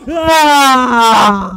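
cri aigu soudain et fort; cri de frayeur. Real, not acted. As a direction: hurle de peur surpris.

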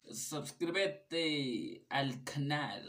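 hammed-up Spanish accent. Reading the translated plug to viewers, very slow mock Spanish accent. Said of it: "I don't know why I'm doing an accent".

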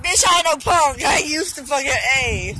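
loud, high, defiant retort; defiant yell. Retold or imitated. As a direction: snap back loud and high. Retelling a defiant comeback.

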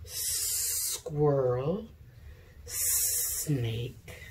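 ordinary talk

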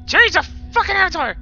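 loud yell. Real, not acted. Yelling at scary avatar.

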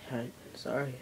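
ordinary talk, nothing marked

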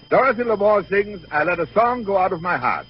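normal talking voice

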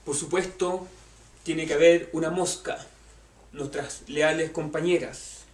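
ordinary talk

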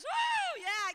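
high, loud whoop of real joy; excited whoop. Real, not acted. Preacher stirred by the Red Sea story, real excited whoop. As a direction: whoop it out, thrilled. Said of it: "It gets me excited".

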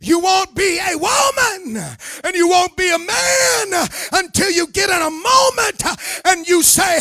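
ordinary talk

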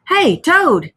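loud, high storybook call. A narrator's reading. Rat and Mole calling to dazed Toad, urgent cry. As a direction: call to a friend for help. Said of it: "they cried".

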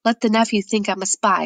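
ordinary delivery

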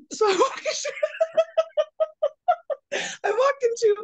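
high, helpless laughter breaking up the words; real laughing fit. Real, not acted. Storyteller confessing she entered the wrong place, cracking up. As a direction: try to get it out through giggles. Breaks up telling the strip club mistake.

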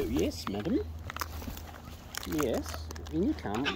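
quiet gentle coaxing; soft coaxing. Real, not acted. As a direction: softly coax the bird in. Coaxing the bird inside.

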